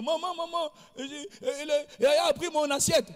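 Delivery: put-on high whiny child calling mum; child tattling. Little boy telling mum his brother took his plate, high whiny tattling. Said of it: "quand tu allais dire".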